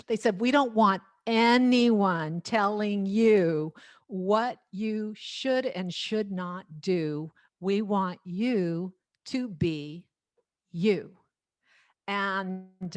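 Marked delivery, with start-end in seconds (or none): 0.4-11.2 retold instruction, slow and deliberate; emphatic. Producers telling the singer to be herself, emphatic. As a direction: spell it out slowly, word by word.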